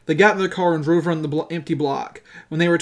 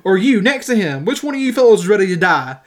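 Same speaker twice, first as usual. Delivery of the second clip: loud, higher confrontational questioning; storyteller acting a pressing, raised threat; hammering on; 6 semitones higher, 6 dB louder than usual. A narrator's reading. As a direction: challenge each man in turn, forcefully. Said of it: "Horton hammered on".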